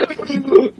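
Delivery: sudden high gasp for air; imitated reviving gasp. Man revives during chest compressions, imitated sudden gasp. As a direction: mimic a sharp intake on coming back to life. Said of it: "like, he did that".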